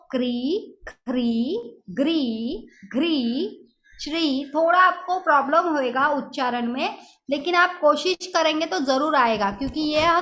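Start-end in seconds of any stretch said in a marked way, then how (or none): 0.1-4.5 each syllable said apart with a clear ri sound; repeat-after-me drill. Shown as a demonstration. Teacher drills class on ri syllables, modeling each. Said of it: "मेरे पीछे सभी जन बोलो".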